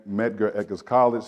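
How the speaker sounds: ordinary talk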